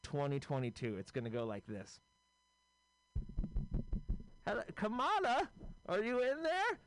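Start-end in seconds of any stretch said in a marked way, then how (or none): 4.4-6.8 put-on much higher, doddering old man voice; a befuddled president, pitched way up; confused old Joe. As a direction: play him lost and calling for help. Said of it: "So here's my impression of".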